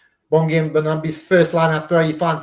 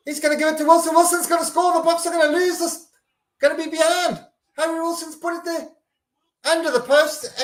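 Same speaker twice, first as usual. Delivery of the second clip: much higher, urgent rapid-fire play-by-play; frantic commentary; 12 semitones higher than usual. Real, not acted. Fan calling the opposing try live, frantic and high. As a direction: panic as the try comes.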